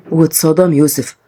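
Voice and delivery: ordinary delivery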